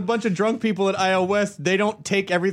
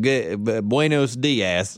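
southern gringo Spanish; 7 semitones lower, slower than usual. Retold or imitated. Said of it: "that southern person".

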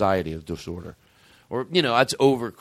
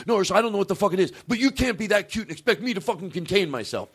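mock yelling at dog; 8 semitones higher, faster than usual. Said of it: "I'd be like".

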